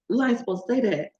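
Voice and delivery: normal talking voice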